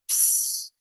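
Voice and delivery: ordinary delivery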